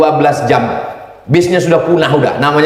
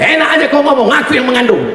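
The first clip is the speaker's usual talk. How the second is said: wife's retort; 9 semitones higher, faster than usual. Retold or imitated. Wife retorts to husband.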